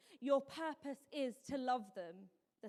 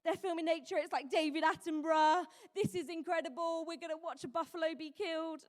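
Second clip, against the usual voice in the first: raised pitch, eager and gushing; excited viewer; 4 semitones higher, 6 dB louder than usual. Retold or imitated. Viewer watching lions grab a buffalo, mock excitement. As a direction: act out a thrilled audience, pitched up.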